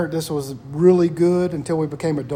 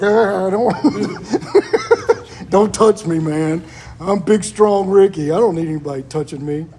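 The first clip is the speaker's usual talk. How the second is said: slow, laughing macho brush-off; tough-guy Ricky; 5 dB louder, slower than usual. Retold or imitated. Ricky refusing a hug from his friend. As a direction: play a proud tough guy pushing a hug away. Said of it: "And he'd be like".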